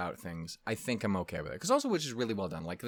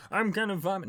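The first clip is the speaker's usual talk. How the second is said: stomach's voice; 9 semitones higher, 4 dB louder than usual. Pretend. Voicing stomach's complaint.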